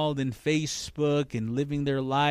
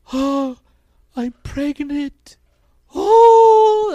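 high weepy vlogger, ending in wail; mock crying; 12 semitones higher, 10 dB louder, slower than usual. Preacher imitating vlogger announcing she's pregnant, mock crying. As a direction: fake tearful announcement, hammed up. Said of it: "she's crying on camera".

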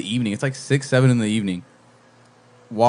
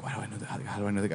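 low, very soft musing; acted thinking to himself, hushed and low; puzzled inner thought; 3 semitones lower, 14 dB quieter, much faster than usual. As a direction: wonder it under your voice.

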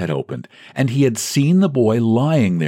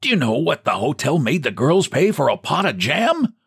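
put-on high, brightened old man's voice; piping; 8 semitones higher than usual. Old clerk brightening to complain, piping. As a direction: pipe it up, suddenly chirpy. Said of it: "he piped".

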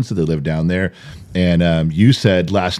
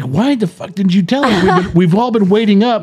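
angry outburst; 10 semitones higher than usual. Retold or imitated. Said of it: "And I'm like".

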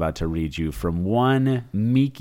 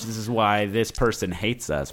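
ordinary talk, nothing marked

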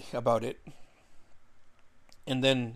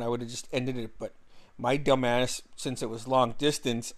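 usual speaking voice

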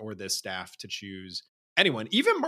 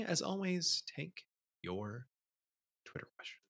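fading mumble; 5 semitones higher, 12 dB quieter than usual. Real, not acted.

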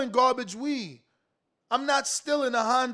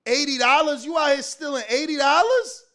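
usual speaking voice

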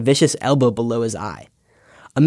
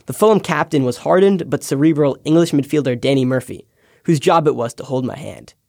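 ordinary talk

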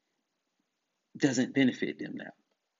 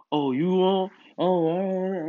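lightly mimicking a crowd piling on; mock the critics, pitched up a little; a hint of mock jeering; 3 semitones higher than usual. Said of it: "they bash me".

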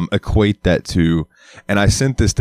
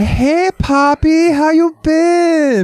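very high, drawn-out mock Miami accent; 4 dB louder, much slower than usual. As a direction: imitate a gushing Miami accent, stretched right out. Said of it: "and then she moved to Miami".

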